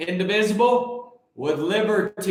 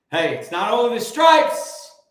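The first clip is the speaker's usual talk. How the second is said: usual speaking voice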